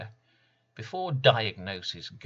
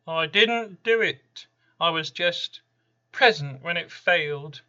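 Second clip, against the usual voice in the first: high, loud, uncanny voice of bad luck itself; Random's protest; 9 semitones higher, 10 dB louder than usual. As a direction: protest innocence as a strange, looming spirit. Random protests his innocence.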